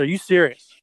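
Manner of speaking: can't believe what he's hearing; loud disbelief. Retold or imitated. Told his friend heads the Vans team, a loud stunned question. Reacting to big news.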